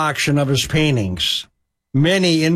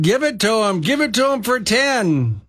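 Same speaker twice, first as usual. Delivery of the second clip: higher crowd yell; 6 semitones higher than usual. A narrator's reading. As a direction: call it out to the auctioneer. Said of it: "People were yelling out".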